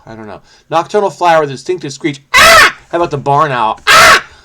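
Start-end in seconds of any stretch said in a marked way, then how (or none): 2.3-2.8 sudden very high, loud owl shriek; barn owl screech. Pretend. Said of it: "that's my impression of a barn owl". 3.9-4.3 sudden very high, loud owl shriek; barn owl screech. Pretend. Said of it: "that's my impression of a barn owl".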